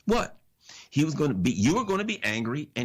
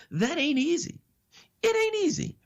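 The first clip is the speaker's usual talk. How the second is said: mock disbelief. Sarcastic aside to the audience.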